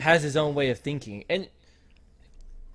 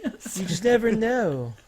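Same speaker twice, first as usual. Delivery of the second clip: creepy impression, higher and drawn out; slow, high-pitched creep act; Cypher; 6 semitones higher, slower than usual. Imitating him close to her face, creepy and slow. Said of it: "like, creepy".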